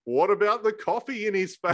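high mock outburst; high, silly imitation of fussing commentators; imitated commentators. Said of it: "the other guys are like".

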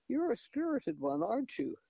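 high, admiring coo to a horse. A narrator's reading. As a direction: coax the horse fondly.

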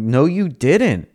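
retold squabble, drawn-out and high; insistent contradiction. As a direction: stretch out an acted-out rebuttal. Retold argument, contradicting her.

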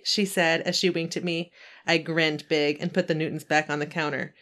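ordinary talk

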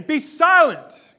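loud, slow, stern command; "Be silent" said loud, slow and high; commanding shout. Shown as a demonstration. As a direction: bark the order be silent. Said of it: "not just saying".

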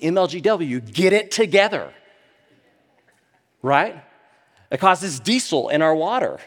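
high, loud preacher building steam; fired-up preaching. Acted. Preacher to his congregation about floods, fired-up and loud. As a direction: preach it hard, rising to the congregation.

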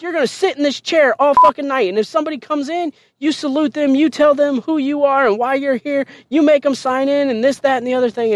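high, insistent list of orders; angry drill sergeant. Retold or imitated. Drill sergeant explaining night duty to recruit, angry orders. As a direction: drum each instruction into the recruit. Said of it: "she's angry, like, all the fucking time".

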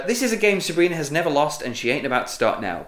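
ordinary talk

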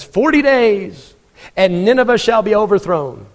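a reluctant prophet booming his doom. Acted. Jonah's short grudging sermon.

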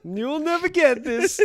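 high, loud mock foreign taunt; Borat. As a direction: crow it in a put-on Eastern European accent. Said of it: "I went a little Borat".

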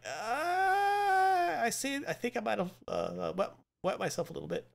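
high, soft, whimpering voice; whimpering fright. Retold or imitated. Symbiote admitting fear to Strange, soft whimpering. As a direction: whimper like you're scared senseless.

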